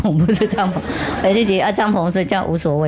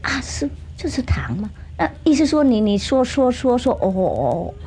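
innocent; 5 semitones higher than usual. Acted. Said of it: "装了很天真的说".

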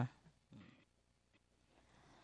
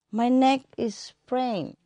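put-on child's excuse; 7 semitones higher, slower than usual.

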